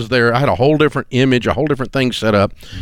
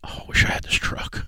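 whispered longing aside; wistful whisper; 9 dB quieter than usual. Real, not acted. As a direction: breathe it out, wishing for the truck. Longing aside about the truck.

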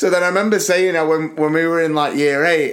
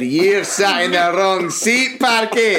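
raised, drawn-out Scottish scolding; mock Scottish teacher; 4 semitones higher, slower than usual. Scottish teacher to a pupil in the wrong seat, mock stern. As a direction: tell off a pupil in a hammed-up Scottish accent. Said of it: "the Scotsman".

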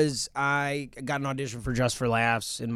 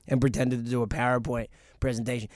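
ordinary talk, nothing marked

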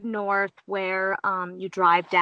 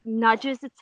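normal talking voice

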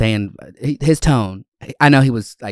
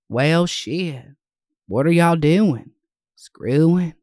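higher, drawn-out tribute impression; Leslie Jordan; 6 semitones higher, 4 dB louder, much slower than usual. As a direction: stretch each catchphrase with swooping pitch. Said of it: "he really got us through some tough times".

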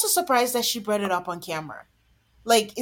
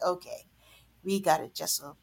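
quiet dismissal; 5 semitones lower, 10 dB quieter than usual. Retold or imitated. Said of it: "I was like".

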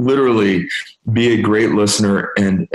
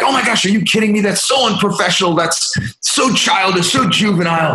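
much higher, indignant mock-outrage rant; ugly American mode; 11 semitones higher than usual. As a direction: parody his younger self ranting like an entitled American, pitched way up. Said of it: "I went into ugly American mode".

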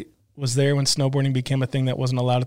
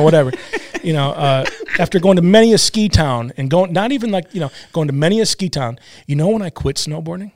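ordinary talk

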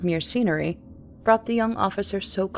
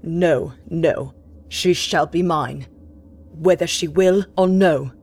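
menacing captain vow; 3 semitones lower, 5 dB louder than usual. Acted.

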